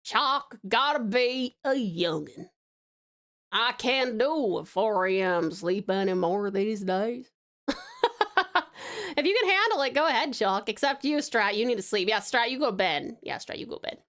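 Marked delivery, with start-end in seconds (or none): 0.0-7.3 slow, drawn-out Southern accent, a bit lower. Shown as a demonstration. Host teaching chat the Southern accent, slow drawl. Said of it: "The Southern worked". 13.2-14.0 breathy whispered repeat, no voice at all. Shown as a demonstration. Host whispering the bedtime order to Strat.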